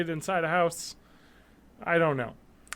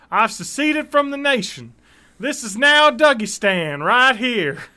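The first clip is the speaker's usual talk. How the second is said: put-on Texas accent; 6 semitones higher, 7 dB louder than usual. Reading a sovereign citizen's 911 call to his co-host in a building, shouting Texas accent. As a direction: play an angry Texan secessionist in a thick Texas accent. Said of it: "let me do my Texas accent".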